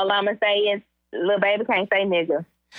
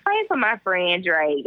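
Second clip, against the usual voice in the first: ordinary talk, nothing marked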